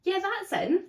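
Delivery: high, breezy customer service voice; high mock shop voice. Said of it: "They go".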